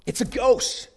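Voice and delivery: high, frightened shout of alarm; terrified cry. A narrator's reading. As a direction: cry out in sudden terror at a ghost. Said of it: "they cried out".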